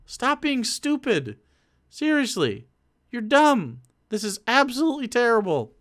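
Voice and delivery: mock campaign bluntness, high and drawn out; mock blunt stump speech; blunt candidate talk. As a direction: scold voters like a fed-up politician. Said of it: "I'm gonna be saying".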